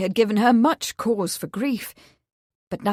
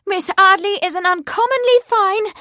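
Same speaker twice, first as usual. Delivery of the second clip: much higher, louder; heated defence; 11 semitones higher, 7 dB louder than usual. Acted. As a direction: defend her friend hotly. Daughter defends friend.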